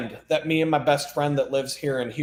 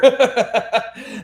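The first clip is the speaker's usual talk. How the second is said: loud laugh; 10 semitones higher, 4 dB louder than usual. Real, not acted. Laughing about friends' story.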